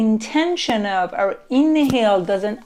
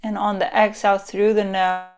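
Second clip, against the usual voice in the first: guided meditation; 2 semitones lower than usual. Acted. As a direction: lull them into relaxing.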